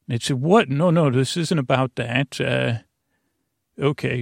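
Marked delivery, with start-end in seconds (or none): none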